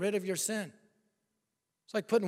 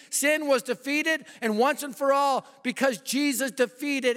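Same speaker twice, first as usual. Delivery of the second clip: preaching at full force; 4 semitones higher than usual. Acted. Preacher thundering the crucifixion to the congregation, full force. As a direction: proclaim it to the whole room.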